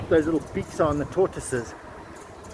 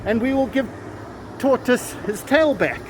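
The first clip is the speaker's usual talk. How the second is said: solemn verdict; 7 semitones higher than usual. Retold or imitated. Magistrate pronounces judgment.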